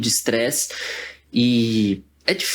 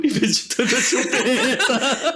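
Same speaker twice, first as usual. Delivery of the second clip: laughing; 10 semitones higher, slower than usual. Real, not acted. Laughing at own joke.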